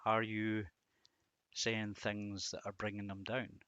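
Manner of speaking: ordinary talk, nothing marked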